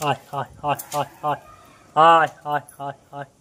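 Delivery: short, evenly spaced calls to birds; calling pigeons. Real, not acted. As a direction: call the pigeons over in quick little bursts. Calling pigeons over to feed.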